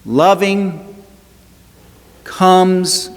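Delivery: each word lifted high and held; lifting Loving and comes high between long pauses; emphatic preaching. Acted. Preacher lifts key line.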